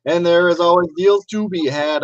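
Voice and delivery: livestream host intro. Acted. Host greeting his viewers, hyped announcer opening. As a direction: open the show like a pumped-up announcer.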